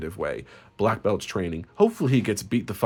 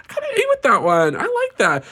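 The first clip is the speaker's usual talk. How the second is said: much higher and louder, delighted with herself; proud squeal; 14 semitones higher, 7 dB louder than usual. Real, not acted. Praising her own joke, loud and high. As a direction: crow about her own line. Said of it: "I kinda ate with that one".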